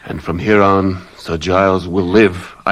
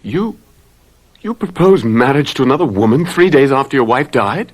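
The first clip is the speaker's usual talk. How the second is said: outraged disbelief; 6 semitones higher than usual. Acted. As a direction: recoil in disbelief, then accuse him. Outraged at the proposal news.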